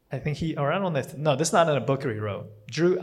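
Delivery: ordinary talk, nothing marked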